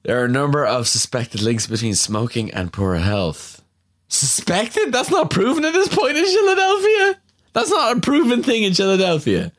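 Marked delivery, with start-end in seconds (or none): 4.1-9.5 high, squeaky disbelief; incredulous. Real, not acted. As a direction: protest in baffled disbelief. Incredulous at game text.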